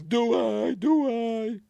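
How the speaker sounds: very high, loud, frightened stammer; panicked squeak. Retold or imitated.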